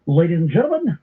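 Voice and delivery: grandiose introduction with dramatic pauses; mock announcer. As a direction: play the showman unveiling a rogues gallery. Reads a Rogues Gallery profile over music.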